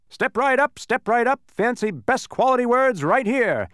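high, loud booming sales pitch. Acted. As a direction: boom it out to the crowd. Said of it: "announced one man in a booming voice".

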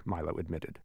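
usual speaking voice

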